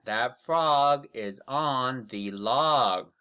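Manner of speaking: ordinary delivery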